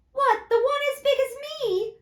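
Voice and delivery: put-on high young boy's hello. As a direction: pitch up to a child. Boy voiced in the reading.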